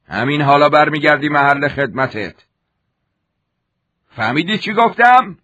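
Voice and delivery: high, loud barking orders; angry commander. Acted. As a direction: dress down the soldier in a rage. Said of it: "با عصبانیت گفت".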